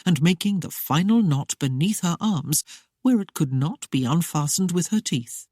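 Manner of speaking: plain reading voice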